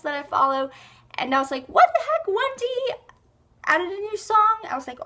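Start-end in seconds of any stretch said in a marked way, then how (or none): 1.7-4.6 very high, loud disbelief; astonished reaction. Retold or imitated. Vlogger retelling her surprise at One Direction's new song, astonished. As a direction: squeal it as a startled question.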